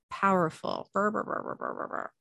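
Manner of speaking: the word repeats softly as a fading echo, then a dying brr; mock reverb trailing away into nothing; spooky echo. Shown as a demonstration.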